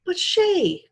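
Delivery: high and desperate; a boy begging for his sister's life; desperately. A narrator's reading. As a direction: plead in desperation. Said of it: "Jed said desperately".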